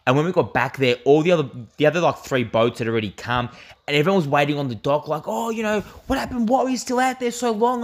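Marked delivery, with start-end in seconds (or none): 5.2-7.8 high-pitched retold crowd voice; mock anxious questioning. People on the dock ask the late boat what happened, high mock-worried voice. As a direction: imitate fretting onlookers, pitched well up.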